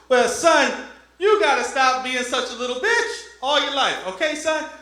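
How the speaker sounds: high, loud mock-preacher father; preachy dad. Dad to son about college money, loud and preachy. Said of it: "my dad's ... religious nut".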